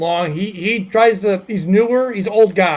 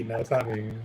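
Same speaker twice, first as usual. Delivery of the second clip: ordinary talk, nothing marked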